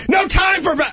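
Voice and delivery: very high mock shout; hammed-up yell. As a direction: cut him off screaming. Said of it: "I would just keep yelling".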